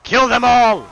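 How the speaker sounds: high, loud rallying shout; battle cry. Pretend. Game king urging knights on, shouted battle cry. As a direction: yell it like a war leader.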